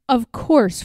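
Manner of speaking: plain reading voice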